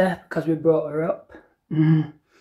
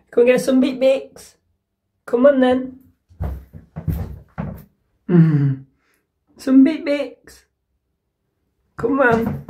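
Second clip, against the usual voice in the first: high sing-song coaxing; 7 semitones higher than usual. Real, not acted. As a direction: lure the cat out with treats.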